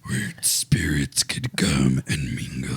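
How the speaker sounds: very low, slow demon growl. Pretend. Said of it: "you sound like a demon over there".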